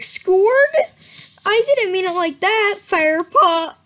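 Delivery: put-on high, loud alarmed girl voice; hammed-up shock. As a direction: make it a big flustered protest.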